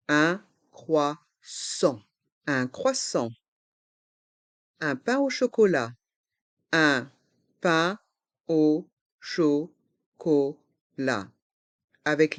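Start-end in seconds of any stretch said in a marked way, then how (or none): 0.1-2.0 slow "Un croissant", each syllable apart; learner-paced, split "Un croissant". Shown as a demonstration. Teacher breaks word into syllables. 6.7-10.6 slow "Un pain au chocolat", a gap after every syllable; learner-paced, split "Un pain au chocolat". Shown as a demonstration. Teacher breaks phrase into syllables.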